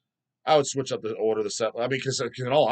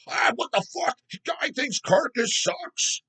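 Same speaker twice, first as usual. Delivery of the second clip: much higher, very slow mock disbelief; mock outraged fan; 12 semitones higher, slower than usual. Imagined fan gasping at his Carcass take, high and slow. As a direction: stretch it out like a scandalized fan. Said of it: "I'm like".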